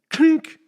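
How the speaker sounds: sharp, loud, high clink; a cartoon hit on the head; loud mock knockout sound; clink sound effect. Imitating the knockout blow.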